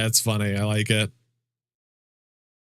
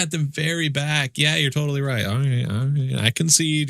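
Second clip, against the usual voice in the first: ordinary talk, nothing marked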